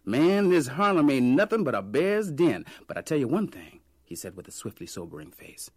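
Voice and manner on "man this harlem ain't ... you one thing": loud, high joking Harlem vendor; jovial vendor. A narrator's reading. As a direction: laugh it off to the stranger. Vendor laughs about Harlem.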